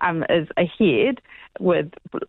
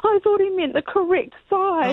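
much higher, bewildered imitation of the friend; squeaky voice for an innocent mix-up; confused friend; 14 semitones higher than usual. Friend explaining her mix-up, imitated much higher. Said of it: "And she was like".

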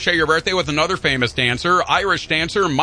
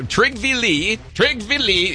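higher-pitched, playful Norwegian accent; put-on Norwegian accent; 6 semitones higher, much slower than usual. Shown as a demonstration. Host repeating the corrected name, put-on Norwegian accent. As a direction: say it confidently with a mock Norwegian lilt. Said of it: "trying to do the accent".

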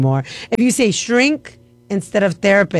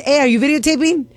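casual question; 6 semitones higher, faster than usual. Shown as a demonstration. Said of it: "I say both".

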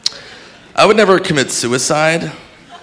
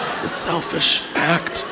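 soft, slow, near-whisper; hushed mock dismay; 9 dB quieter than usual. Comic laments drowned baby's act, hushed mock dismay. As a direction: repeat it slowly under your breath.

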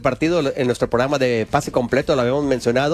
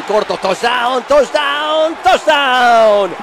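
building to a screamed touchdown call; excited play-by-play; 9 semitones higher, 5 dB louder than usual. Real, not acted. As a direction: shout touchdown, higher each time. Narra en vivo el touchdown. (narración eufórica de gol)

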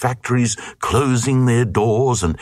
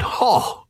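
a high exclaimed ho; mock-pleased burst; lightly hammed royal ho; 13 semitones higher than usual. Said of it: "And the king said".

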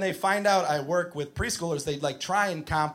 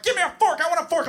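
screaming preschooler; 12 semitones higher, much faster than usual. Retold or imitated. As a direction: yell it like a kid on the edge of a tantrum. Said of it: "He's like".

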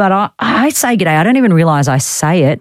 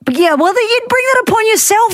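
very high-pitched protest; mock exasperation. Real, not acted. Host scolding her producer over howdy, exasperated.

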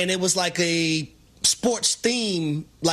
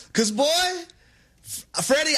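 normal talking voice

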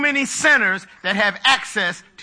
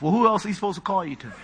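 usual speaking voice

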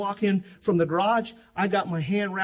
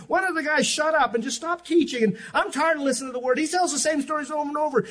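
a hint of a whiny churchgoer, higher; mock complainer; 9 semitones higher, 4 dB louder than usual. Preacher imitating a bored complaining churchgoer, mock whiny. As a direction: lightly mimic the grumbling attendee.

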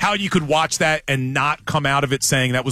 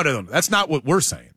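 ordinary talk

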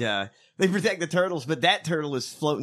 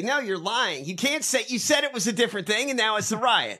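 higher-pitched indignant rebuttal; reporter pushing back; 7 semitones higher than usual. Acted. Reporter confronting an evasive politician, indignant. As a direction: challenge the lie in a raised pitch. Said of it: "This reporter has to say".

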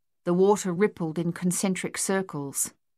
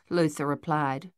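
usual speaking voice

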